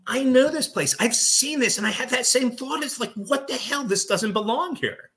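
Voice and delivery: imitating a spooked radio host; Hawes freaking out. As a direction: squeal it like a host losing it on air. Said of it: "He's like".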